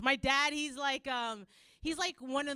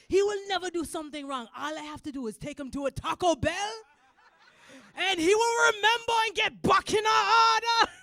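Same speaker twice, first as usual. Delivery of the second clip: high, loud Jamaican dad impression; excited dad; 8 semitones higher, 12 dB louder than usual. Dad sure prison will straighten his son, excited shout. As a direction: build to a triumphant shout. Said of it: "he was excited".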